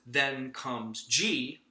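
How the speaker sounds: very slow, G drawn out; slow demo line. Shown as a demonstration. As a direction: spell it out slowly. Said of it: "Let me demonstrate".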